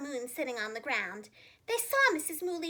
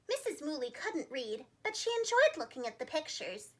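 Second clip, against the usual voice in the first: ordinary delivery, nothing marked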